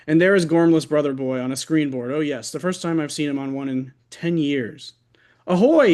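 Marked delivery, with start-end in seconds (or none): 5.5-5.9 loud high hail across the yard; shout. Acted. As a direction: call out Ahoy from a board. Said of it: "he shouts".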